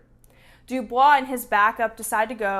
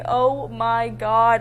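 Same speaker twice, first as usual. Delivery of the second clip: overwhelmed; 6 dB louder, much slower than usual. Real, not acted. Overwhelmed recalling audiobook.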